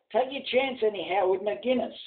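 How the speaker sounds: priest's shout. Acted. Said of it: "the priest gave a shout".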